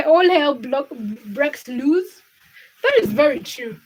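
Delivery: ordinary talk, nothing marked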